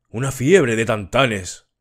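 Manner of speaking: ordinary delivery, nothing marked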